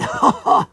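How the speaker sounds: high, loud character laugh; put-on iguana guffaw. Iguana laughing at his dirty joke, high loud guffaw. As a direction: burst out cackling as a creature.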